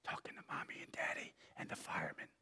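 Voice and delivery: fast, voiceless whisper; child's whisper. A narrator's reading. Child explaining to the boss on the phone, whispering. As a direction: rush it out in a hidden whisper. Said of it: "whispered the child".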